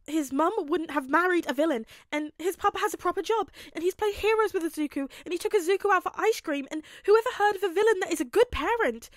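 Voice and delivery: words tumbling out in mounting hysteria; hysterical spiral. Acted. Izuku denying his papa could be a villain, hysterical.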